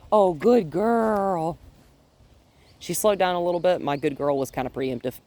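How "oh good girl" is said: drawn-out praise. Real, not acted. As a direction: stretch out the praise for the dog. Praising the dog.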